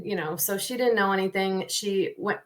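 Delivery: usual speaking voice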